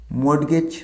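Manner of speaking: মর্টগেজ with a sounded t, "mort-gech"; common mispronunciation. Shown as a demonstration. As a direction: say both parts of মর্টগেজ, "mot-gech". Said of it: "এটাকে আমরা কী বলি?". (ভুল মর্টগেজ, "ট" উচ্চারণ করে)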